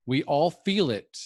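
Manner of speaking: ordinary talk, nothing marked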